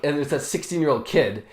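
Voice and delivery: ordinary delivery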